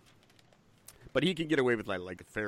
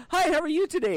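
much higher, louder chirpy mock greeting; 8 dB louder than usual. Coworkers greeting the manager at Mohawk, mock chirpy and high. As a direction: sing it out like a cheery coworker. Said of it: "people go".

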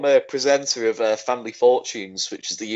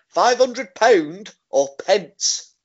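slow incredulous question; 8 semitones higher, much slower than usual. Retold or imitated. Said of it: "Paddy's like".

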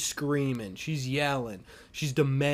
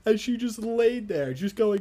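usual speaking voice

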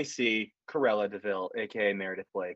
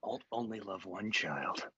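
creepy mom to camera; 3 semitones lower, 6 dB quieter than usual. Retold or imitated. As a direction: murmur it into the camera like an eerie mom. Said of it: "at the audience and said".

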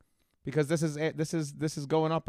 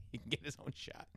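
whispered laugh; 12 dB quieter than usual. Real, not acted. Laughing repeat of partner's joke.

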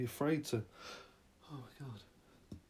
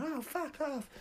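manic squeal; 14 semitones higher, 4 dB quieter, slower than usual. Acted. People flashing others.

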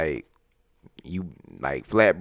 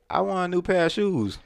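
lightly voiced girl asking, pitched higher; slightly higher-pitched mock request; imitating a girl; 6 semitones higher, 3 dB quieter than usual.

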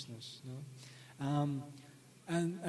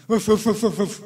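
loud barking woofs, like a dog that won't stop; 4 semitones higher, 14 dB louder than usual. Retold or imitated. Preacher to audience, acting out the devil as a loud barking dog. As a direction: bark it out at full volume like a dog. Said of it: "you can hear it barking like a dog".

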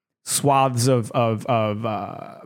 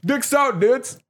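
jock bro voice; 9 semitones higher than usual. Retold or imitated. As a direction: play a dopey gym bro. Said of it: "the jock dudes who were like".

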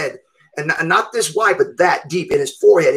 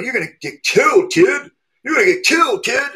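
urgent warning; 7 semitones higher than usual. Retold or imitated. Crowd warns he'll be killed.

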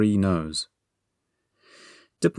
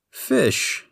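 high-pitched exclamation; triumphant cry; 12 semitones higher than usual. A narrator's reading. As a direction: blurt the answer out high. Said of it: "he cried".

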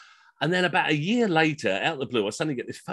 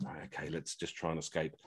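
much softer, low whispered escape plan; muttered aside; 8 semitones lower, 16 dB quieter than usual. Retold or imitated. Stuck with deaf guests, muttering about escaping, very soft and low. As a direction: mutter it under your breath. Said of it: "And you're like".

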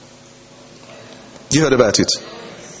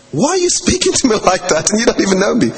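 indignant challenge; 6 semitones higher than usual. Retold or imitated. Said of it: "I said".

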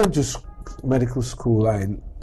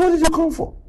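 aunt's suspicious question; 14 semitones higher, 4 dB louder, faster than usual. Retold or imitated. Aunt voiced in the story.

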